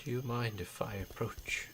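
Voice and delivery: soft unvoiced whisper. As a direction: breathe the question softly. Old man NPC whispers.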